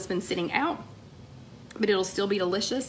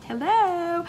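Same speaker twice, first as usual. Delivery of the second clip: excited hello; 10 semitones higher, 3 dB louder than usual. Real, not acted. Squealed greeting to viewer.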